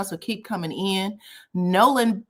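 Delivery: ordinary delivery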